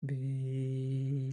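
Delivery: long, steady "V" on one breath; sustained calming vocal exhale; breathing-exercise hum. Shown as a demonstration. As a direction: draw the V out evenly until the air runs out. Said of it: "just breathe out".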